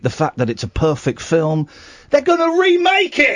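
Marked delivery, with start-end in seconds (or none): 2.1-3.4 mock outrage. Mock outrage at remake news.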